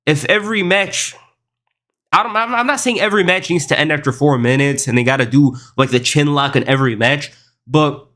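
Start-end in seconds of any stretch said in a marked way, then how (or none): none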